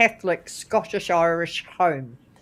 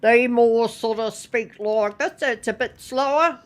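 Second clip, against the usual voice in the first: slightly raised North Island lilt, rising at the end; North Island accent; 3 semitones higher than usual. Shown as a demonstration. Guest shows how northerners speak, North Island accent. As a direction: North Island talk with an upturn on the last word. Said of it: "it goes up at the end".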